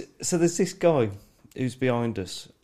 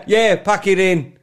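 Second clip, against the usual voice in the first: slow, loud echo of a heckle; echoing commentator; 5 semitones higher, 6 dB louder than usual. Retold or imitated. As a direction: imitate the fan parroting the call. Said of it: "he would repeat what I'm doing".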